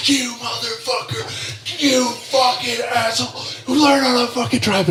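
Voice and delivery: high, drawn-out imitated road-rage yell, held back in volume; mock furious yell. Enraged old lawyer type at the driver blocking him, mock furious yell. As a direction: imitate a driver flipping out without full volume. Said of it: "He is at a ten".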